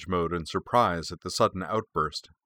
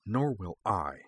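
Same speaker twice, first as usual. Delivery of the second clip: softer, barely audible, seething; quiet fury; 5 dB quieter, slower than usual. A narrator's reading. Frey to his sister before stalking off, quietly furious. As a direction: hold the rage down to almost nothing. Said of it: "his voice nearly inaudible with fury".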